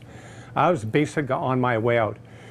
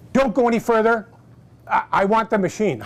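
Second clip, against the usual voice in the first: eager interruption; 6 semitones higher, 4 dB louder than usual. Retold or imitated. Wife interrupting demo to buy.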